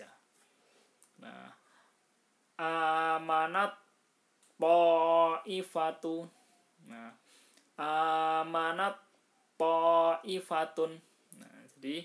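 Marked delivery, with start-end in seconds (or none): 2.6-6.3 "amanat poifatu" chanted slow and loud, the t melting into a doubled heavy t; "amanat poifatu" as slow tajwid recitation, first t absorbed; merged ta into to. Shown as a demonstration. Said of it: "taknya dihapus atau diulangkan". 7.8-11.0 "amanat poifatun" chanted slow and loud, the t melting into a doubled heavy t; "amanat poifatun" as slow tajwid recitation, first t absorbed; merged ta into to. Shown as a demonstration. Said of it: "taknya dihapus atau diulangkan".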